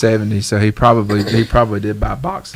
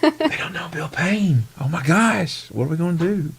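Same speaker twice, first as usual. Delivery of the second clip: mock gasp; 7 semitones higher, 3 dB quieter than usual. Mock shock at guests.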